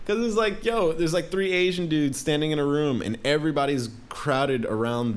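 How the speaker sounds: high, eager rush of a story; bursting to tell it; excited. Real, not acted. Excited retelling, laughing.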